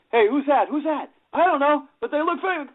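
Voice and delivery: high, eager gawking voices; excited tourists. Retold or imitated. Tourists spotting the band at Graceland, high excited gawking. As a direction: squeal like fans spotting a star.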